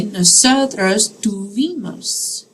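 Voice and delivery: slow and clear, for students to copy; teacher modelling a verb form slowly; slow model phrase. Shown as a demonstration. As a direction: say "Nosotros tuvimos" slowly, every syllable clear. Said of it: "I would say".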